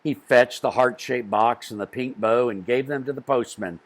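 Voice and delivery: ordinary delivery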